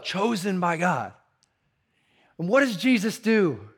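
ordinary talk

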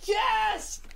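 high-pitched screamed yes; full-out scream. Retold or imitated. Excited student answers the teacher's quiz question, screaming at full pitch. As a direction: scream it like an overexcited pupil. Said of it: "just screams as loud as he fucking can".

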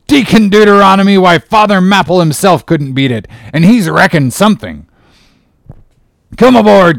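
high, loud blustering sea captain; excited captain bellowing orders; loud cry. A narrator's reading. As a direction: holler it out with hearty gusto. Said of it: "cried Peleg".

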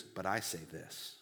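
low, soft, weighty declaration; hushed authority of Jesus; heavenly authority. Acted. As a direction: drop low and quiet as a sovereign pronouncement. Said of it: "Jesus is speaking with heavenly authority".

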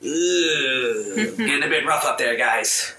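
lightly voiced mischievous imp; sly, playful little imp; impish voice. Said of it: "the familiar impish voice comes".

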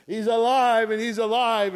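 Mary crying out that Jesus lives; joyful shout. Acted. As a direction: shout the good news with joy. Mary rejoicing at the empty tomb.

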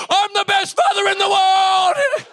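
high, drawn-out triumphant yell; exultant father. Retold or imitated. Father at the back as his son rides, exultant yell. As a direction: shout it in hammed-up elation.

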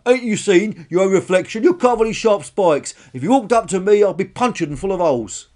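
high, loud character voice; alarmed armoured dinosaur, protesting. Acted. Small dinosaur refuses to approach spiky one, loud protest.

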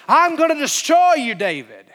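high loud threat dropping on the name; threatening roar. Retold or imitated. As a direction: bellow a giant's threat. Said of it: "he literally said".

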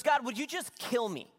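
high, exasperated mock plea; miserable Jonah. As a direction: imitate a fed-up prophet begging to be done. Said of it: "Jonah's just miserable".

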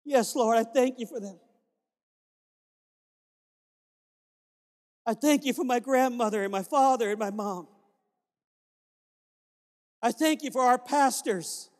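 fervent spoken prayer to God. Acted. Pastor leading the church in thanks, praying aloud. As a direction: lift it up to the Lord with feeling.

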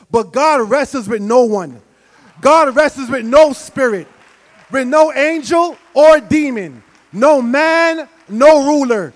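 from steady to loud and high; building preaching. Acted. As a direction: preach it up to a shout.

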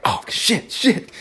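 high, breathy, half-voiced panic; imitating a sudden waking panic; panicked. Waking to missed calls, mock panicked gasp. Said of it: "And I wake up and I'm like".

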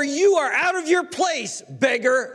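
high, indignant put-down; acted outrage; contemptuous scolding. Scolding crowd voiced by preacher.

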